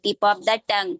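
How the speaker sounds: ordinary talk, nothing marked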